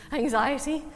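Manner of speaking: sharp gasp, then the word anxiety; hammed-up gasped Anxiety; gasp of mock alarm. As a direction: gasp before Anxiety as if struck by dread. Mock reaction to taxes news.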